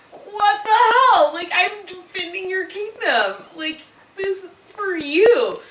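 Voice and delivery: hammed-up indignation; indignant outburst. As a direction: imitate exasperated outrage at being abandoned. Acted outraged complaint to deserters.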